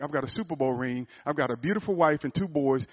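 normal talking voice

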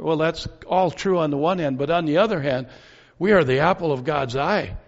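ordinary talk

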